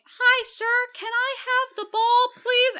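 put-on high, loud childlike plea; little kid voice. A kid asks Odin for the ball, high and sweet. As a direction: pipe up like a small kid. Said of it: "a kid's like".

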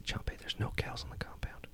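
very soft, whispered accusation; menacing whisper. Acted. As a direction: confront the servant in a hushed voice. Master interrogates servant.

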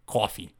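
"Coffee" with a rounded AW; low imitation of New York "Coffee"; exaggerated imitation. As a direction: say "Coffee" low, as careful CAW-fee. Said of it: "That was just as exaggerated".

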